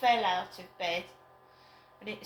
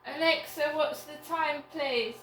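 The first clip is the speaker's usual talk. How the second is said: raised, higher voice to a device; 5 semitones higher than usual. Real, not acted. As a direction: call it out clearly across the room. Commanding smart speaker.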